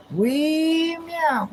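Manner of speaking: high squeaky kitty meow, playful; cat voice. Pretend. Meowing along with the cat on stream, high cat voice. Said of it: "we over here meowing it up".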